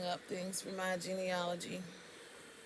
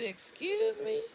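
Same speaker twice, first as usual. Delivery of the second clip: high, shocked "Excuse me?"; outraged disbelief; indignant excuse me; 14 semitones higher, slower than usual. Retold or imitated. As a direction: act offended, pitch jumps up. Offended caller to rude pharmacist.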